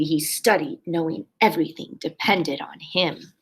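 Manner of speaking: normal talking voice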